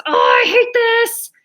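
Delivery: loud, high, drawn-out cry of exasperation; acted frustrated outburst. Girl who slipped with the laundry, acted exasperation. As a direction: play up a child's tantrum.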